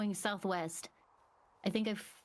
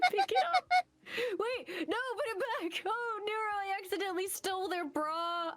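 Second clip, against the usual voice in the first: excited; 12 semitones higher, 3 dB louder than usual. Real, not acted. High pitched excited.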